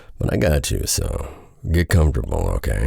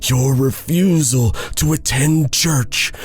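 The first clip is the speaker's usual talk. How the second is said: much higher, stern accusing judge voice; stern pastor; 14 semitones higher than usual. Acted. Pastor Isaac accusing Henry before the church, high stern voice. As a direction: lay out the charges like a verdict.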